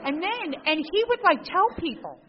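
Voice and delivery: bursting to tell the next part; excited. Real, not acted. Excited telling.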